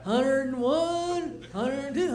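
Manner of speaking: counting threads very slowly; slow tedious counting. Pretend. A bored thread counter counting threads slowly. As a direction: count each one with care.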